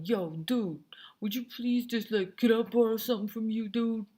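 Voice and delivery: hammed-up slangy request to borrow something; impolite dude. Teacher mimicking a student rudely asking the president, slangy dude voice. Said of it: "That's not polite. That's not right".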